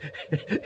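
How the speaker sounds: amused chuckle. Acted. Outlaw tickled by the slick stranger, amused chuckle. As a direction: chuckle quietly at being outwitted.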